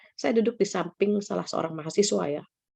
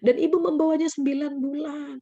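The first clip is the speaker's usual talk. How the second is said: higher, slowed impassioned retelling; heartfelt appeal; 6 semitones higher than usual. Retold or imitated. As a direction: act out a child's awed realization. Student's emotional appeal to mother, acted.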